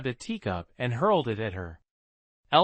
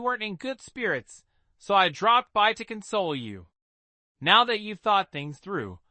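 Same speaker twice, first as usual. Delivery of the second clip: higher, falsely polite voices; a lightly voiced mocking courtesy; sisters in mock courtesy; 6 semitones higher than usual. Sisters exchanging polite barbs.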